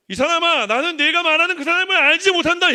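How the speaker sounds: loud, high shouted denial; angry denial. Acted. Peter loudly denying Jesus to the crowd, shouting.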